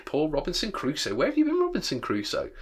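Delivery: high, sing-out parrot mimicry; parrot's call. A narrator's reading. Imitating the parrot's call in story.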